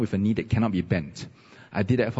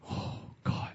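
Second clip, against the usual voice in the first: relieved whisper; 12 dB quieter than usual. Retold or imitated. Relief, retold prayer.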